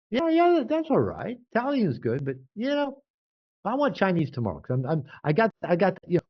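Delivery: high, bright give and take; lightly voiced easygoing partner; imitating easygoing reply. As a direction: keep it friendly but stake a claim. Retold reciprocal dinner talk.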